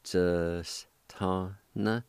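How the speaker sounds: "Just tana" drawn out very slowly, syllable by syllable. Shown as a demonstration. Teacher slowly modelling the word for students, syllable by syllable. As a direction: stretch each syllable for the learner. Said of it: "pronounced like this".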